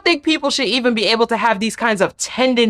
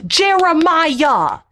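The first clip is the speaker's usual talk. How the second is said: a slow, higher mock plea of his name; drawn-out whiny call; 8 semitones higher, much slower than usual. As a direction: stretch the name out slowly, higher, with a sulky drag. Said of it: "like this".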